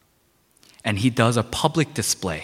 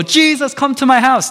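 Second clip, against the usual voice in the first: much higher, louder call up to Jesus; mock calling out; 6 dB louder than usual. Zacchaeus shouting down to Jesus, a hammed-up call. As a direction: holler it like a man waving from a tree. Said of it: "He didn't go".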